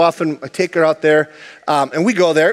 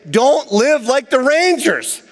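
higher, slow mock warning from Paul; 7 semitones higher, slower than usual. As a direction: lay down the rule slowly, as Paul. Paul quoted warning rangers.

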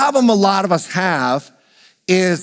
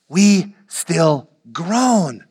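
very slow, weighty delivery; drawn-out groaning; 2 semitones lower, much slower than usual. Shown as a demonstration. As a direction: stretch each word out, long on "groan". Slow emphatic repeat of quote.

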